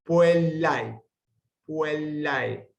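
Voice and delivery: puellai with ae as one syllable ai; diphthong demo. Shown as a demonstration. Teacher modelling the ae diphthong for students. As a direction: say puellai, ending gliding ai. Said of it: "debe pronunciarse siempre en una misma sílaba".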